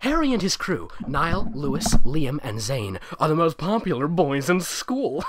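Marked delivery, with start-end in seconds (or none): none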